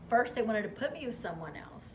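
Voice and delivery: normal talking voice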